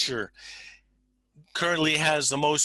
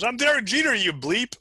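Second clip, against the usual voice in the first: high, loud mock protest; indignant mock outburst; 10 semitones higher, 4 dB louder than usual. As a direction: yell it like an outraged victim, hammed up. Shot player protests in retold scene.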